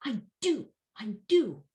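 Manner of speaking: high, forceful stress on DO; emphatic DO. Shown as a demonstration. Teacher showing students the emphatic form, punched DO. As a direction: punch DO like a fist coming down. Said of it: "you take your fist and".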